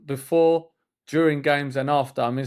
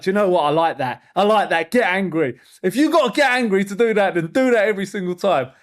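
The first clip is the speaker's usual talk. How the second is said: louder, higher pep talk to the son; fired-up encouragement; 4 semitones higher, 4 dB louder than usual. Retold or imitated. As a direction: gee him up to get angry. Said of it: "And I was like".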